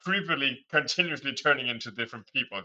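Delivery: usual speaking voice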